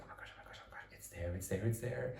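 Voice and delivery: hushed, overwhelmed repeating; whispering in disbelief on seeing the castle; awed whisper. Retold or imitated. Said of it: "I was like".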